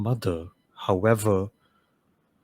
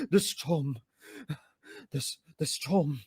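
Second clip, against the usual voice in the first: muttered prayer; 5 semitones higher, 6 dB quieter than usual. Acted.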